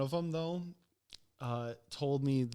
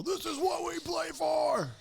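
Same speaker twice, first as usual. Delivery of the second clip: high, drawn-out hammed-up sports battle cry; over-the-top mock game-day yell; mock rallying shout. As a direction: holler it like a pretend pre-game pep talk.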